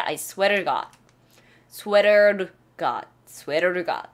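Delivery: "to" as a quick flap "ruh" each time; flapped to. Shown as a demonstration. 학생들에게 to를 르로 반복 시범. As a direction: repeat with "to" tapped like "r". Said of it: "to는 르로 발음 나요".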